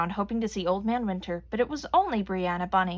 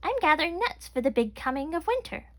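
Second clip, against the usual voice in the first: high tiny chipmunk voice; squeaky chipmunk voice; 8 semitones higher than usual. A narrator's reading. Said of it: "tiny Chelsea Chipmunk".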